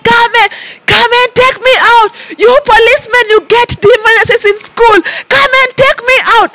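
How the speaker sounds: high, yelling demands from the cell; retold shouting. Locked in a police cell, shouting at the policemen, high. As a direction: holler at the police to let you out. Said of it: "I was shouting".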